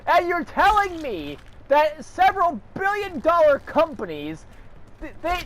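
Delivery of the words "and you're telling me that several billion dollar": high-pitched furious rant at the console maker; real indignant ranting; angry rant. Real, not acted. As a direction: let the outrage spill over, pitch up high. Ranting at PlayStation over membership.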